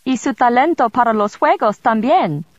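a sly royal aside; Rudolph in Spanish. Acted.

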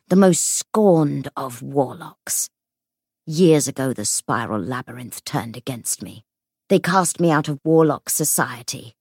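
hollow ghost voice. Acted. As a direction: speak like an empty dead warlock. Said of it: "its voice hollow".

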